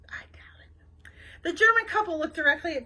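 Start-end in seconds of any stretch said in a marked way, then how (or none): 0.1-1.1 slow, voiceless whisper; whispered aside. A narrator's reading. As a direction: whisper it doubtfully under your breath. Sceptical aside under the breath.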